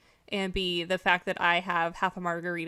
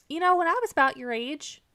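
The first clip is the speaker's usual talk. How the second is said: mom voice; 9 semitones higher, 4 dB louder than usual. Retold or imitated. Mother voiced in story.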